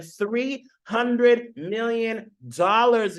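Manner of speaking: "three hundred million dollars" drawn out slowly, word by word; awed emphasis. Real, not acted. Host impressing viewers with "three hundred million dollars", each word slow and stressed.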